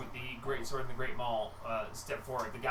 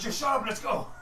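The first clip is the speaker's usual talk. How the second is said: impatient; 11 semitones higher, 8 dB louder than usual. Acted. Impatient dragonborn.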